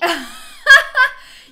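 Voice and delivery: loud, high laugh; real burst of laughter. Real, not acted. Hearing fan gets banned for looking twelve, bursting laughter. Amused by banned fan's story.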